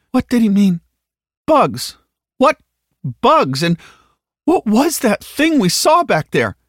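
high, loud, frightened demands; panicked questioning. Acted. Halliday roused from weepy fugue, asking in alarm. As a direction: panic rising with each question.

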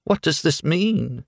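high, swooning Southern belle; fluttery high romance heroine; faint cry. A narrator's reading. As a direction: ask it faintly, overcome. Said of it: "she cried faintly".

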